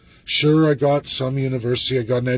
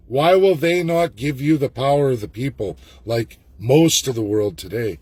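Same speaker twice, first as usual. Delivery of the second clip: ordinary delivery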